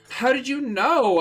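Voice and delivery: sudden shock; surprised. Acted. Ebony startled by Voldemort's threat, very high and loud. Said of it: "I asked in a surprised way".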